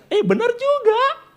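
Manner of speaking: preacher hamming up a surprised agreement; mock surprise. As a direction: play it as a comic realization. Mock reaction, high and slow.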